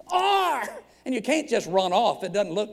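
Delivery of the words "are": high, drawn-out word, imitating an admirer; hammed-up amazement on one word; awestruck mock exclamation. Retold compliment, word by word.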